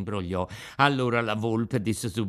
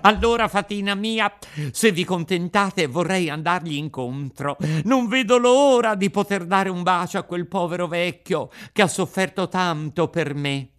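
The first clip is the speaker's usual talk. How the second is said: higher, louder, eager puppet voice; can't wait to kiss his father; 7 semitones higher, 5 dB louder than usual. Acted. Pinocchio eager to meet father. (Pinocchio impaziente, voce alta e forte)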